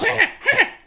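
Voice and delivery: high snickering cartoon laugh; Beavis laugh. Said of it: "Beavis and Butthead".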